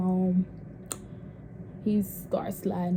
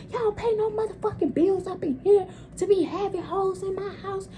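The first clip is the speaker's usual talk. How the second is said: much higher, scolding auntie tirade; furious auntie rant; 10 semitones higher than usual. Retold or imitated. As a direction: chase the girl out of her house. Auntie yelling her out.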